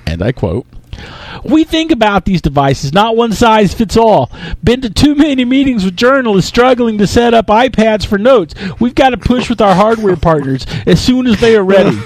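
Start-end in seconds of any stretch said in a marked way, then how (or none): 1.4-12.0 high, hammed-up shouting CEO; Steve Ballmer. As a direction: bellow every phrase like an over-excited executive rallying analysts. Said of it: "absolutely fantastic Steve Ballmer impression".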